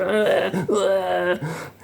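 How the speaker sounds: high drawn-out mock wail; mock girlish scream. Beaten troll on the floor, mock screaming. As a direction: imitate a squealing little girl.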